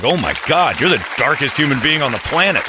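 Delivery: hammed-up shocked outburst; mock outrage. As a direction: exclaim it like you can't believe her. Mock-outraged reply to dark joke.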